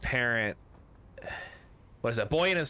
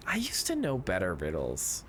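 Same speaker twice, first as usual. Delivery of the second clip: ordinary talk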